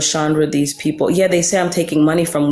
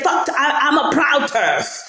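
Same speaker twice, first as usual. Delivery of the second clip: proud declaration; 8 semitones higher, slower than usual. Retold or imitated. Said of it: "She's like".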